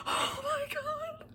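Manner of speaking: high, slow, gasping squeak; overwhelmed fan gasp; gasping squeal. Real, not acted. Gasps after reading passage.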